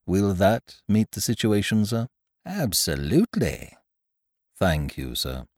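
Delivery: low, measured manservant; quiet deferential butler, low and even; lightly voiced butler. Butler Jeeves speaks to employer.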